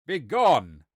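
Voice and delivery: loud, angry dismissal; cried out. Acted. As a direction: shout to send her packing. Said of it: "cried the son".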